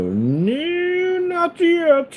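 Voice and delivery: high, loud drawn-out no; emphatic refusal. Acted. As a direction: belt out a big high 'not yet'.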